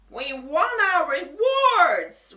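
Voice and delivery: loud, slow roar; lion roaring at the wizard. A narrator's reading. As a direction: roar it with a sob in it. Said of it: "roared the lion".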